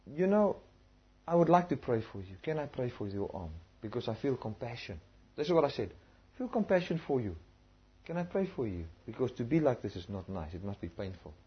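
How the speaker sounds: soft, caring offer to pray; quiet tender voice offering prayer; tender compassion. Retold or imitated. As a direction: speak gently, full of compassion.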